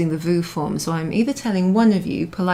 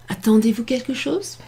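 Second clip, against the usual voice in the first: "Attendez-vous quelque chose" pitched higher, rising on "chose"; question, not statement; 5 semitones higher than usual. Shown as a demonstration. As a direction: ask it with a clear lift at "chose". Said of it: "to make it not a statement anymore".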